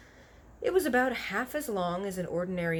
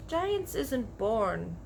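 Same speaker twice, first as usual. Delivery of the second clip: slow explanation; 3 semitones higher, much slower than usual. Acted. Giant explains slowly.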